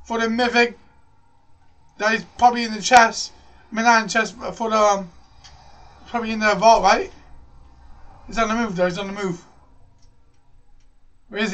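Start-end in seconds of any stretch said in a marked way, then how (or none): none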